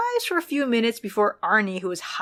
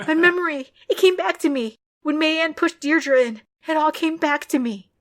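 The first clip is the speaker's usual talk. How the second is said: high, louder frantic confession; distraught girl; 8 semitones higher, 4 dB louder than usual. A narrator's reading. Narrator voices the distraught girl, high and loud. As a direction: pour it out high and upset.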